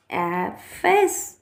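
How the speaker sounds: ऐप, फैस with every vowel stretched very slowly; long vowel demo. Shown as a demonstration. Teacher modelling long vowels for the class, slow and stretched. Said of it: "इसकी आपको ध्वनि थोड़ी लंबी निकालनी है".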